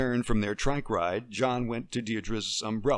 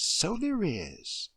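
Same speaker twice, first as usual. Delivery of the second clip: higher, very slow repeat, savoring it; slower indulgent echo; 10 semitones higher, much slower than usual. Acted. Deirdre repeating her agreement to Emily, drawn out.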